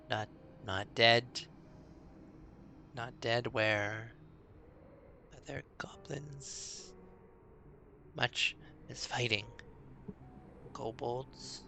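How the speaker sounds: a scared small creature fumbling for words; nervous goblin. Acted. Goblin tells the orc about the battle in a soft, slow, nervous creature voice.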